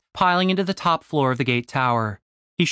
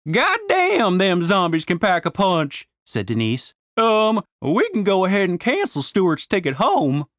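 Southern woman voice; 5 semitones higher than usual. A narrator's reading. Denise, higher character voice.

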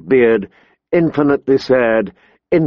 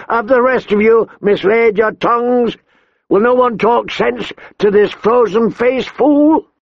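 blustering angry king; 11 semitones higher than usual. A narrator's reading. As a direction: berate the whole council. King berates the council.

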